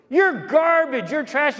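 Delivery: high, loud, hateful insults; imitated angry shouting. Woman cursing outside the rectory door, imitated shouting. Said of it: "and she's shouting".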